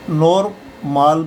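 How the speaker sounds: "नॉर्मल" as nor-maal, O then a long AA; spelling reading. Shown as a demonstration. Teacher shows a possible misreading, "नॉर्मल" as nor-maal. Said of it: "तो इसको हम".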